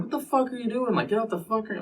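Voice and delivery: imitating himself losing his temper; retold snap at the passenger; mock angry outburst. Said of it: "I'm, like, popping off".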